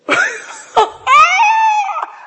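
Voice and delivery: high, loud burst of laughter. Real, not acted. Pastor laughing at the story, loud high laugh. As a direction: howl with laughter.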